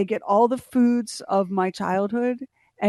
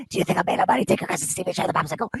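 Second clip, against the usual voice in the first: put-on hushed raspy Sicilian accent, then fast; gruff Sicilian grandpa; much faster than usual. Grandpa scolds the grandson about money, raspy Sicilian accent. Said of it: "very thick Sicilian accent".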